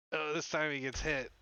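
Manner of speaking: normal talking voice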